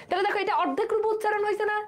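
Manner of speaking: normal talking voice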